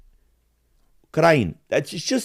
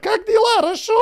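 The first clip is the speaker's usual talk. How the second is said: very high, squeaky falsetto greeting; mocking a Ukrainian in falsetto; squeaky Ukrainian version. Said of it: "It's the same fucking".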